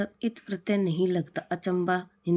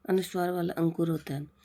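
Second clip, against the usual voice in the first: usual speaking voice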